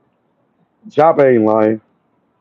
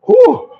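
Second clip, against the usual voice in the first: hot pepper whew. Real, not acted. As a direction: blow out the heat of the pepper. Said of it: "My mouth is on fire".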